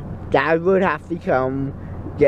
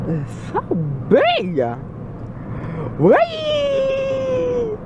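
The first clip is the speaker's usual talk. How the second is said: high giddy whee; giddy squeal; much slower than usual. Real, not acted. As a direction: squeal with goofy delight. Loopy excitement.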